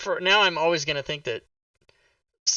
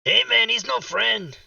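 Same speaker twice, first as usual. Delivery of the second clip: high stoner-comedy impression; 6 semitones higher than usual. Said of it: "[name] is just [name] from [name] and [name]".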